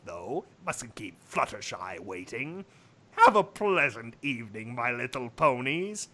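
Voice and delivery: deep Discord voice. Acted. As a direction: play the sly chaos spirit low. Discord leaves.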